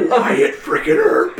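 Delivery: high, slow, laughing shout; shouted quote. Retold or imitated. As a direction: bellow the name, laughing. Quoting movie line, shouted.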